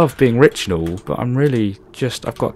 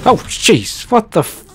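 high, startled exclamation; 9 semitones higher than usual. Real, not acted. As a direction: jolt with fright, voice leaping up. Startled by something in the game.

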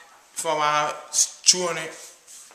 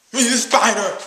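higher, loud panicked cry; scared yell; 6 semitones higher, 9 dB louder than usual. Acted. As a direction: shriek at the spider. Sees spider, yells.

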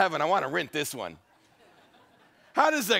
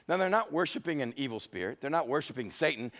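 ordinary delivery

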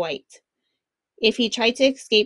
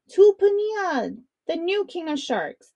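cheering; 8 semitones higher than usual. A narrator's reading. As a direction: hail the new hero. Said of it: "The villagers cheered".

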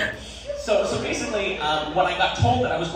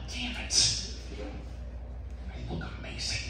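1 hushed mock annoyance; 4 semitones higher, 8 dB quieter, faster than usual. Inner thought after haircut.

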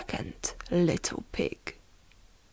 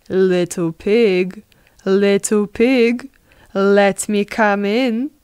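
louder, measured call in a put-on wolf voice; 6 dB louder than usual. Wolf at the pig's door asking entry, calling. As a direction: call out each phrase as the storybook wolf.

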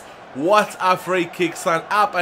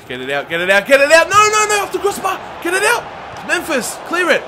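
high panicked shouting at players; yelling from the sidelines; 9 semitones higher, 6 dB louder than usual. Real, not acted. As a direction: scream at the defence to clear it. Defending free kick, yelling at players.

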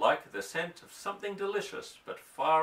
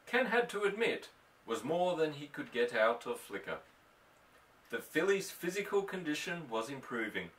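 ordinary delivery

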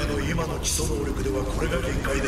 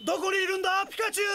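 high shout calling for someone; much faster than usual. Acted. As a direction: call out searching everywhere. Searching, calling for Pikachu. (呼びかける叫び声)

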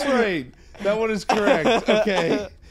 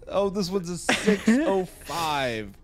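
normal talking voice

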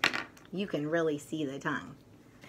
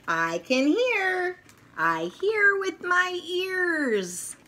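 higher, slower toy character voice; toy's voice; 14 semitones higher, 5 dB louder, slower than usual. Acted. Potato Head toy proudly showing off his new ears, high and slow.

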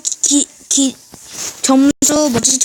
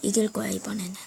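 whispering; 4 semitones lower, 10 dB quieter, faster than usual. Real, not acted. Whispered vow while playing.

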